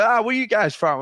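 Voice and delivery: high, loud cheery greeting; mock friendly stranger. Cocky doctor greeting Aussie riders at a bar, high cheery mock. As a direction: imitate a brash bar guy.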